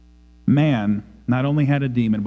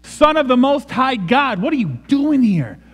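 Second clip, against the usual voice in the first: high, agitated outcry; tormented cry; 8 semitones higher than usual. Acted. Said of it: "Sounds tormented".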